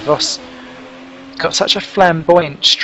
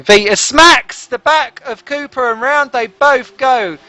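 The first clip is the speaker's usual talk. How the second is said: excited crash call; 9 semitones higher, slower than usual. Real, not acted. Commentator reacting to a crash on track, excited. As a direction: pitch jumps higher as the spin happens.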